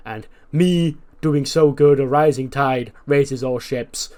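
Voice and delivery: slow, loud put-on swagger; hammed-up bragging wrestler voice; boastful Jericho. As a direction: drag it out like a smug champion.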